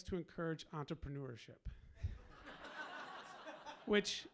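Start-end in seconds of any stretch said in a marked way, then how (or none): 1.7-3.8 high breathy laugh; airy, amused laugh. Real, not acted. Laughs at mayor's excuse.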